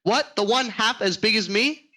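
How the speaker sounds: high, incredulous workman; astonished. Acted. Workman asked about the huge prize turkey, astonished. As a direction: ask it in cheeky disbelief.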